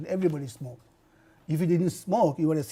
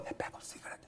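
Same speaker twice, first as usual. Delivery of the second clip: whispered; 19 dB quieter, faster than usual. Retold or imitated. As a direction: whisper it, ashamed. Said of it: "today you will go and say".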